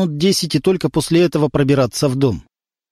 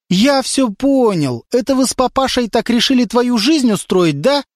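higher, rising to a demand; shouting; 9 semitones higher than usual. A narrator's reading. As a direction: build to a demand for an answer. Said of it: "закричал Лёня". (Лёня кричит на Леру, требуя ответа)

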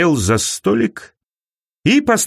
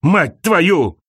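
cried-out curses; 5 semitones higher, much slower than usual. A narrator's reading. Injured mortals swearing at heaven, high-pitched mild outcry. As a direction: spit out two separate curses, pitched up. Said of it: "выкрикивают ругательства".

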